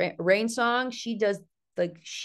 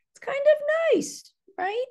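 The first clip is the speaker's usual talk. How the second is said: high squeak. Acted.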